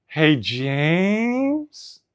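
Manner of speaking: long rising "Hey, James" like a question; dad calling his son in a worried rising tone; ominous rising tone. Retold or imitated. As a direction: stretch "James" upward, worrying. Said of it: "That crescendo is like a question".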